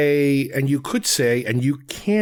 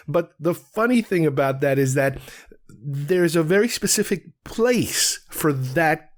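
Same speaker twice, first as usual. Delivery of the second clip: usual speaking voice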